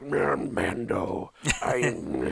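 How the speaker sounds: low, mumbled character voice; Nick Nolte. Acted. Podcaster imitates the actor in a show, low mumble. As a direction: sink low and mush the words together. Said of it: "It's just like".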